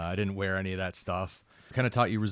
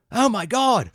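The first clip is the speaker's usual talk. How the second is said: shocked Australian tourist, very high and loud; hammed-up Australian exclamation; bad Australian accent; 10 dB louder, slower than usual. Australian tourists who just watched a bike flip, bad Australian accent, astonished. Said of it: "I, a horrible accent".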